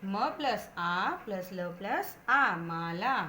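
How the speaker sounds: each sound drawn out slowly, one by one; slow spelled-out blending. Shown as a demonstration. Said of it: "जैसे कि उदाहरण देखिए बच्चों". (धीरे-धीरे अक्षर जोड़कर)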